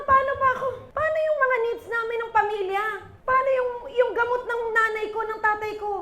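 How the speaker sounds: a worried believer begging over family needs; anxious pleading. Acted. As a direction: voice a frightened believer, high and pleading. Worried believer voiced by preacher.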